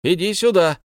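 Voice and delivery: high, loud call. A narrator's reading. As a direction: shout it across the dark. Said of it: "закричал Бильбо громче".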